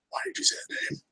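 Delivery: breathy hushed whisper. Real, not acted. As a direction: whisper it under your breath. Whispered reaction to chat.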